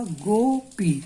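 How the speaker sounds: Gopi stretched very slowly as गो-पी; two clear strokes, गो then पी; broken into syllables. Shown as a demonstration. Teacher showing students the two pieces, very slow. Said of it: "तो दो टुकड़ा हुआ".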